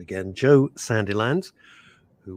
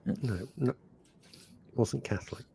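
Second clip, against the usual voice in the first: whispered aside; whispering; 9 dB quieter than usual. Real, not acted. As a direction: drop to a whisper. Aside during funeral story.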